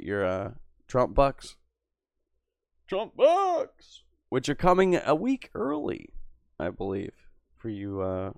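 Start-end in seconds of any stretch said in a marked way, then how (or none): 2.9-6.1 squealed high mock-excited cry; mock excitement. Streamer to chat, squealed mock excitement. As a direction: yelp it like a game-show host.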